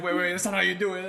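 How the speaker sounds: high, loud mock alarm; baffled protest. Fan retelling his protest at the trailer, high and loud. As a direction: object in hyped disbelief.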